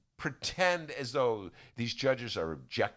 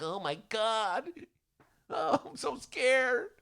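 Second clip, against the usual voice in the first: mock fear; 10 semitones higher, slower than usual. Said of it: "Democrats are like".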